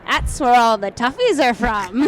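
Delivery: imitated Australian post office clerk; amused Australian accent, drawn to a laugh. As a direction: put on an Aussie accent for the punchline. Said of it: "I will use an Australian accent".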